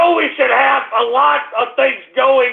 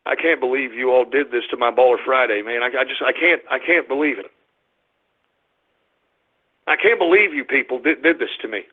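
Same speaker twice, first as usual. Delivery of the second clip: very low, subdued monotone; crushed disbelief; 11 semitones lower, 4 dB quieter than usual. Real, not acted. Host betrayed on his Baller Friday, crushed disbelief. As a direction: sink into wounded, defeated muttering. Wounded by trolls ruining his show.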